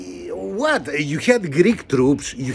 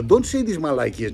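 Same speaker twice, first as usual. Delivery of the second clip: usual speaking voice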